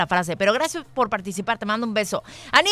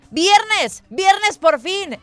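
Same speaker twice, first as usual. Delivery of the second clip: excited; 8 semitones higher, 5 dB louder, much slower than usual. Real, not acted. Celebrating Friday on morning show.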